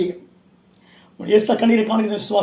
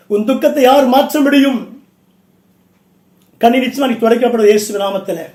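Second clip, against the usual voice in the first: raised, insistent preacher's voice; forceful preaching; 3 semitones higher, 6 dB louder than usual. Real, not acted. Preacher urging weeping brothers and sisters not to fear, forceful preaching. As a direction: exhort them with ringing conviction.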